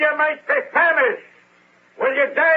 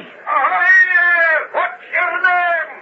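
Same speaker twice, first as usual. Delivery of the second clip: hailing shout; 6 semitones higher, much slower than usual. Acted. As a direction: call out across the water to him. Boatman hailing from the sea.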